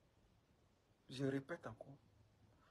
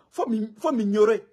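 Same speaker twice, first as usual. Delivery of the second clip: loud retort; 5 semitones higher, 11 dB louder than usual. Real, not acted. Tells critic to ignore him.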